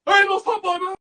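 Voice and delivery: high, loud movie-gangster impression; Tony Montana. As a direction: yell it like a wired crime boss. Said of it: "you saw Tony Montana go".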